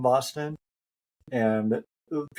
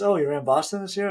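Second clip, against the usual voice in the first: higher, lightly surprised question; imitated curious question; 8 semitones higher, faster than usual. Race director asking a runner about Boston, curious. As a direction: mimic someone pleasantly noticing.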